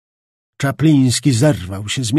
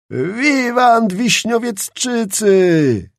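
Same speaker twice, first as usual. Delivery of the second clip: very slow, higher, louder cheer; 7 semitones higher, 4 dB louder, much slower than usual. A narrator's reading. Nobles in the tavern cheering the Wiśniowiecki men, slow loud cheer. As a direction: raise a triumphant cry. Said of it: "wołali jedni".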